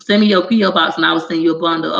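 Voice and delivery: ordinary delivery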